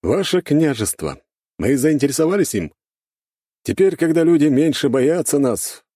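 high, emphatic character voice; formal blond envoy. A narrator's reading. Blond man explains interest in the principality, formal.

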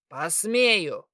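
loud, low, savage retort; menacing satyr. A narrator's reading. Satyr lunging at the defiant girl, menacing. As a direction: throw it back low and forceful.

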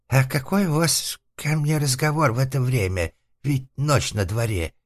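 high, whiny, wary voice; timid recluse. Acted. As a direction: object timidly through a closed door. Затворник робко возражает через дверь. (робкий высокий голос затворника)

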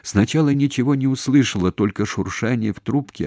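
ordinary delivery, nothing marked

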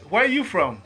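ordinary delivery

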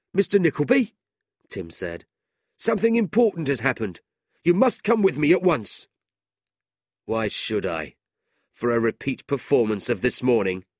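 high, fast urgent clerk; old clerk summoning Ralph, high and pressing; urgent Tim. A narrator's reading. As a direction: hurry him out the door.

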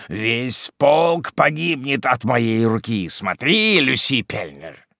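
fierce deliberate menace; vengeful threat. Acted. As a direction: swear revenge with rising force. Hermit vows bloody revenge.

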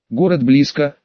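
ordinary delivery, nothing marked

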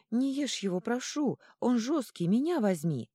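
put-on high, loud young voice pleading; young ringing voice, hurried. A narrator's reading. Young gnome begging the troll, high and hurried. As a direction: rush the plea in a bright, high voice. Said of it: "молодой и звонкий голос торопливо добавил".

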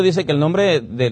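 ordinary talk, nothing marked

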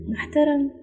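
high-pitched, scornful echoed question; sarcastic. A narrator's reading. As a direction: throw the word back in mocking disbelief. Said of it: "وتساءل متهكما". (بتهكم واستخفاف)

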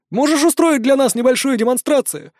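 high curious gnome voice; eager little gnome asking. Acted.

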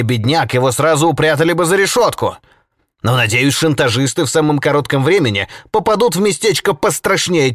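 loud, indignant high-pitched tirade; angry cry. A narrator's reading. Holket curses the blackmailers to prison and hell, loud and furious. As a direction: rant in outrage at the blackmailers. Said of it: "вскричал Холкет".

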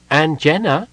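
mumbled through a mouthful; mouth full. A narrator's reading. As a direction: talk around a bite of oatmeal. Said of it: "Tas mumbled, his mouth full".

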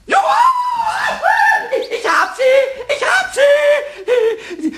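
very high, loud, drawn-out squeal; triumphant shout. Acted. Triumph at catching the mouse. (schrilles Triumphgeschrei, langgezogen)

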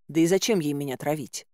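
usual speaking voice

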